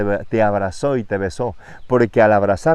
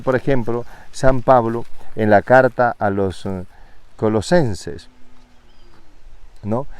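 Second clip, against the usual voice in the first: usual speaking voice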